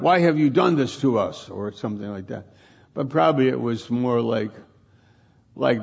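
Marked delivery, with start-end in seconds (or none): 0.0-1.5 higher, quick challenge; combative. Shown as a demonstration. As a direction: demand it like an accusation. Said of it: "the tone of her voice wasn't combative".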